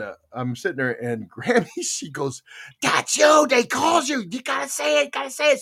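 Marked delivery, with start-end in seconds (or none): 2.8-5.6 high, loud shouted hurry-up; grandma shouting at her to claim the number; excited urging. Retold or imitated. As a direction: push her to say it now.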